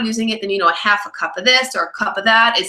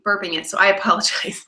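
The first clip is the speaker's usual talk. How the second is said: usual speaking voice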